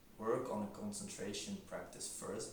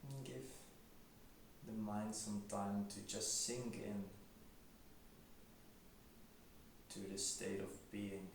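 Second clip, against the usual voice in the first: quiet, unhurried meditation voice; guided meditation; 10 dB quieter than usual. Acted. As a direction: gently guide them into stillness, leaving long silences.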